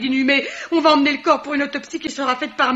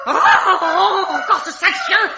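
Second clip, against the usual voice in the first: exasperated outcry; 6 semitones higher, 5 dB louder, slower than usual. Acted. Landlady exasperated by dog.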